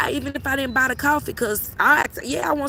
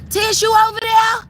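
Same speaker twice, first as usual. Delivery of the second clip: higher, louder neighbor call; 9 semitones higher, 6 dB louder than usual. Pretend. Imagining calling Tish next door, shouting. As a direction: holler across the yard.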